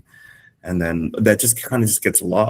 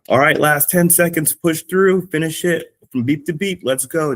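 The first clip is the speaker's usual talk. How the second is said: high, loud, driving push to the finish; high-energy hype cue; 9 semitones higher, 3 dB louder than usual. Shown as a demonstration. Fitness coach hyping the class through the final seconds, high and loud. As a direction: hype them through the last ten seconds. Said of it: "my tone is gonna change from".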